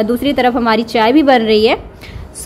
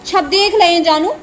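put-on sing-song cooing to a baby, higher and lilting; baby talk; 4 semitones higher than usual. Aunt playing with her baby niece, sing-song baby talk. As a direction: coo and tease the little one like a doting aunt.

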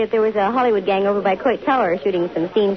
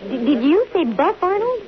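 急に声が跳ね上がる驚き; a sharp high jolt of surprise at the name; startled surprise; 7 semitones higher than usual. Acted. Hears an old flame's name.

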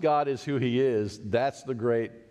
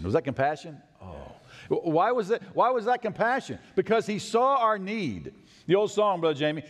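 ordinary talk, nothing marked

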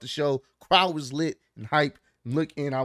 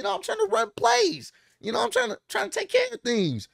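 high, whiny, eager voice; Jimmy pleading with Roman in a high, eager voice; imitating Jimmy. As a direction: play the overeager little brother pleading his case.

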